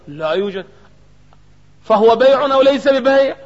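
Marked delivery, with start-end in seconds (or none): 1.8-3.4 loud challenge. Real, not acted. Teacher challenges class.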